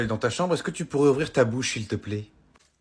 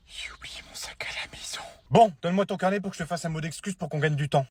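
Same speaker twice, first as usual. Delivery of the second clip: whisper; 3 semitones higher, 4 dB quieter than usual. Acted. Boy whispers panicked aside.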